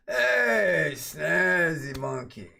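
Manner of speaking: loud, high and slowed, calling out the name; excited greeting. Real, not acted. Streamer loudly greets a new follower by name, drawn out.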